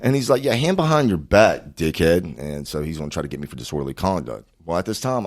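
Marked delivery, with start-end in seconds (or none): 0.4-2.4 lightly acted tough cop, raised and slowed; retold cop's order. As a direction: give a mock police command, loud on the first words. Retold cop ordering him handcuffed.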